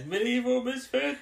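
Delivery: high, slow villainous yell; hammed-up Master shout. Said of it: "the Master just yells".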